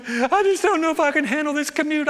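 lightly voiced anxious daughter; mock worried young woman; high, mildly whining daughter. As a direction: pitch it high and a little fretful. Daughter complains on phone.

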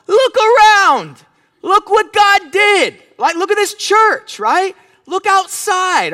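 high, loud, drawn-out exhortation; rousing shout. Acted. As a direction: shout to wake the congregation up. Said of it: "wake up a little bit and go".

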